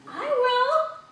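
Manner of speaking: high, loud volunteering; eager duck. A narrator's reading. Duck offering to eat the bread, high and eager. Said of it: "said the duck".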